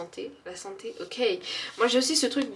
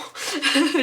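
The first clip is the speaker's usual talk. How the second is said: higher, louder little laugh; pleased laugh; 6 semitones higher, 6 dB louder than usual. Real, not acted. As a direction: laugh at how quick it was. Laughs at fast answers. (petit rire content, plus aigu et fort)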